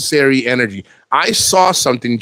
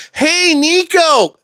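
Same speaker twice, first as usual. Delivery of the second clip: sing-song high, drawn-out Hey Nico; high, slow sing-song hello; mock fan greeting; much slower than usual. Mocked fan greeting in videos.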